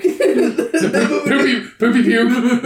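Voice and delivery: giggling through a silly name; laughing mispronunciation. Real, not acted. Friend mangles the monster's name, laughing. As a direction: mangle the name while cracking up.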